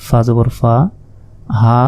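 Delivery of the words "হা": the final "হা" held very long and even; four-alif elongation. Shown as a demonstration. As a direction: sustain the vowel of "হা" for four beats. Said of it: "চার আলিফ". ("হা" চার আলিফ টেনে পড়া)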